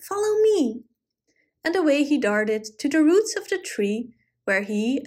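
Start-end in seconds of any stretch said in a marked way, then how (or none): 0.0-0.8 very high, sprightly command; cried out. A narrator's reading. Goblin leading the squirrels to their nuts, high and sprightly. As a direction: lure them along playfully. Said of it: "he cried".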